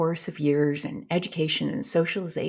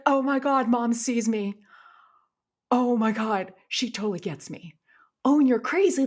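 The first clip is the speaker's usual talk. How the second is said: excited realisation; 6 semitones higher, 4 dB louder than usual. Retold or imitated. Retold delighted realisation.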